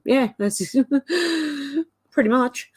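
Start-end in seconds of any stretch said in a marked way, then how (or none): 0.8-1.8 sudden high burst of laughter. Real, not acted. Streamer reading chat, bursts out laughing. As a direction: crack up at the joke comment.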